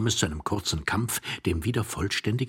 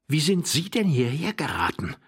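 higher, edgy questioning; irritated hushed tone; 9 semitones higher, 4 dB louder than usual. Acted. Young man under the bed grilling his companion, irritated. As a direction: demand it testily. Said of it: "in gereiztem Flüsterton".

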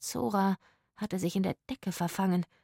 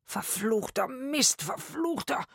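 slow, strained, higher-pitched curse; pained cursing; 6 semitones higher, slower than usual. A narrator's reading. Man clutching his bad back, pained cursing. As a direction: curse through a stab of back pain. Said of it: "hielt sich den Rücken".

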